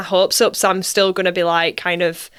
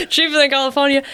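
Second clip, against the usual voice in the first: laughing; 7 semitones higher, faster than usual. Real, not acted. Repeats with laugh.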